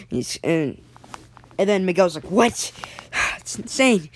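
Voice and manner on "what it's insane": higher-pitched, gasping disbelief; shocked disbelief. Acted. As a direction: react to terrible news, catching breath between phrases. Miguel hears friend is dead.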